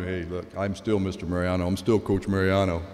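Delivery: low-pitched voice of authority, lightly acted; imitated stern coach. Coach reasserting his authority.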